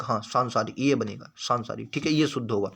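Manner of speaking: ordinary talk, nothing marked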